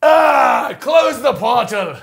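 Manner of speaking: loud, high cry, close the portal dragged slow; mock strained shout. Closing the portal on the ad, loud mock strain. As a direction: strain as if shoving a portal shut. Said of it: "we gotta close it now".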